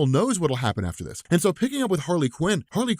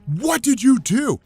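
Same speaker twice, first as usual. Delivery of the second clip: higher, louder, slow anguished yell; retold scream; 8 semitones higher, 5 dB louder, slower than usual. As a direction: scream it slowly at the culprit. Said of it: "while he's crying and just screaming".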